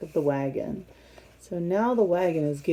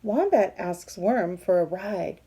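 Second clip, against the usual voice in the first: plain reading voice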